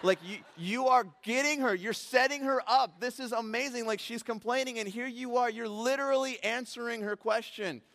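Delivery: high, loud, amazed praise; excited marvel. Retold or imitated. Pastor to God, marvelling loudly and excitedly. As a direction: gush with delight at God's timing.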